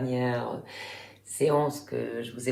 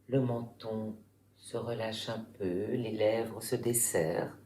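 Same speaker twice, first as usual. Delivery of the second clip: guided relaxation; 3 semitones lower, 8 dB quieter than usual. Real, not acted. Yoga teacher guiding listeners lying in Shavasana, calm meditation voice. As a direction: guide them calmly through the body, softer and lower, unhurried.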